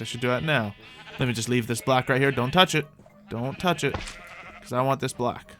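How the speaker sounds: ordinary talk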